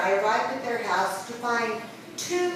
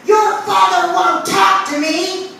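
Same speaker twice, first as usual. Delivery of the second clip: mother shouting; loud, high outburst; 8 semitones higher, 10 dB louder than usual. Retold or imitated. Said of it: "My mom said loudly".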